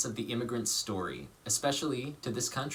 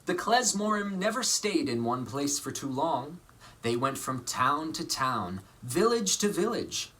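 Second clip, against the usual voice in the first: lively picture-book reading voice; story narration. A narrator's reading. Actor reading a picture book to children, lively storytelling. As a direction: read it warmly to children.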